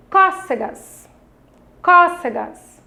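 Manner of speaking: CÓCEGAS with CÓ pitched high; syllable stress demo. Shown as a demonstration. Teacher leads children to the tonic syllable, Cócegas stressed on CÓ. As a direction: punch the first syllable of Cócegas high, then fall away. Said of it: "ler com maior intensidade a palavra".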